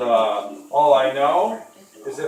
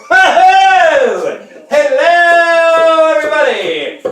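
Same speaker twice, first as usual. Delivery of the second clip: 1 hearty TV-host hello; 11 semitones higher, 10 dB louder, much slower than usual. Acted. Greeting viewers like a TV host.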